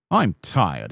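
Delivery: plain reading voice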